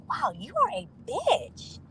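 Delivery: slow, drawn-out putdown; mock amazed insult. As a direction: stretch each word in exaggerated amazement. Retold insult to the woman.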